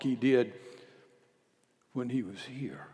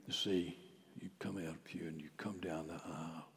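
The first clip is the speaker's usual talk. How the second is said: hushed; 7 semitones lower, 9 dB quieter than usual. Real, not acted. Quiet description of communion.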